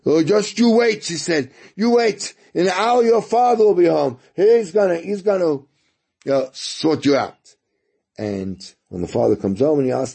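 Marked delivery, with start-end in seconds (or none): 0.1-1.0 a mother's raised-voice threat; threatening mother. Retold or imitated. 1.7-7.5 a mother's raised-voice threat; threatening mother. Retold or imitated.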